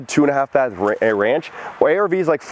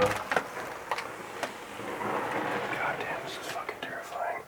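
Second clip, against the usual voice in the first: terrified hushed whisper; scared whisper; 3 semitones lower, 16 dB quieter than usual. Real, not acted. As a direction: whisper it, spooked. Scared inside dark house.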